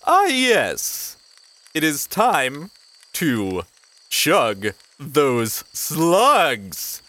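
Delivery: high goblin announcer, drawing out the last words; Slorb's goblin voice. Acted. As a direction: proclaim it slowly like a goblin herald. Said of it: "and then goes".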